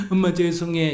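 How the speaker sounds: hammed-up tearful plea to mom; fake crying apology. Shown as a demonstration. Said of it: "이건 거짓말이다". (엄마한테 우는 척 과장되게 사과)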